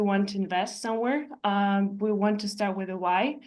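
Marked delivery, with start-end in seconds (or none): none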